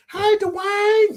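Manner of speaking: put-on high, drawn-out country-accented squeal; Whitley Gilbert country accent. Imitating a sitcom character's greeting, high country accent. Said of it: "you know, that country accent".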